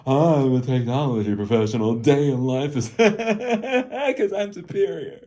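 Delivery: high, drawn-out, laughing mock snob; highfalutin. Mocking influencers' day-in-life videos, hammed-up highfalutin. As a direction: put on a self-important tech professional voice, loud and high. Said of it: "this highfalutin".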